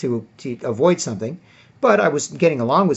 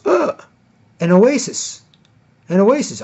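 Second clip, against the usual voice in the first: high, louder cry of relief; relieved guy; 7 semitones higher, 4 dB louder, slower than usual. Retold or imitated. A guy finding the friendly table, high relieved cry. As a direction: gasp it out like finding water in a desert. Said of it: "he sees us and he goes".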